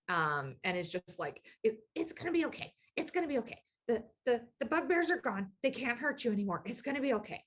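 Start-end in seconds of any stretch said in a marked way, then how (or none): 1.6-7.4 high, hurried little goblin voice; small tearful voice hurrying to comfort itself; little crying voice. Acted. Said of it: "this little voice that is still crying".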